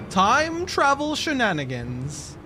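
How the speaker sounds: slow, high, stretched-out announcement; playful proclamation. Pretend. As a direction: draw out each word with theatrical relish.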